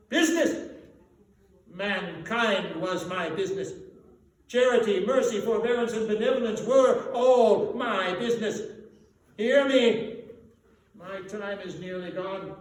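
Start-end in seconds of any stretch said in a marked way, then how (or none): 0.1-10.2 loud, high, outraged wail; anguished ghost cry. A narrator's reading. Marley's ghost rebuking Scrooge, loud and anguished. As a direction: cry out against the word business.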